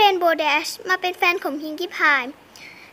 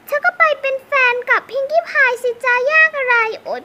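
sassy brush-off; 6 semitones higher, 4 dB louder, slower than usual. Pretend. Pony dismisses rival suitor.

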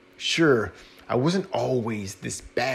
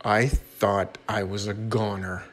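ordinary delivery, nothing marked

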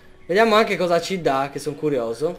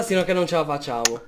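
ordinary talk, nothing marked

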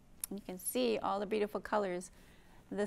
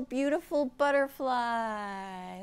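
lively storybook reading; reading to children; 3 semitones higher, 4 dB louder than usual. Acted. Teacher reading a picture book aloud, lively. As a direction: read it to a circle of little kids.

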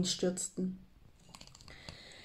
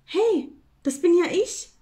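high, loud and thrilled; excited discovery; 8 semitones higher, 6 dB louder than usual. A narrator's reading. Squirrel sees itself in the mirror, excited discovery. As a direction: burst out in surprised delight.

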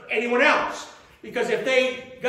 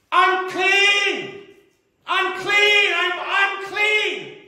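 loud high cry of warning; a leper crying out unclean from afar; desperate yell; 9 semitones higher, 5 dB louder, much slower than usual. Acted. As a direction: shout it out so everyone hears. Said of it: "he had to yell".

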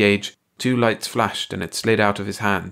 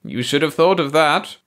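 high alien voice; 7 semitones higher, 4 dB louder than usual. A narrator's reading. Baldy answers.

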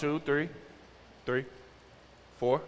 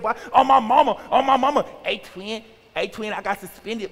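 put-on swaggering teen-boy parody, pitched up; mock teenage boy; 5 semitones higher than usual. Teen boys at a rivalry game, mock tough bragging. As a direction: run it together like a boasting young knucklehead. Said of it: "all the teenagers are all around me, and all of 'em".

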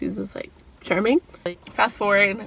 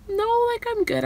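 higher, nervous brush-off; flustered denial; 13 semitones higher than usual. Retold or imitated. Insisting to Jake she's fine while her heart races, high and flustered. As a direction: squeak out that you're fine.

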